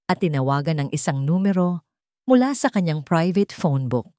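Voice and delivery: ordinary delivery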